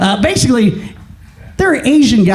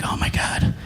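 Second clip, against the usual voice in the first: breathless whispered oh my God; whispered gasp; 11 dB quieter than usual. Retold or imitated. Whispering to himself as Nancy breaks the news. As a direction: whisper it in shock. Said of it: "And I'm like".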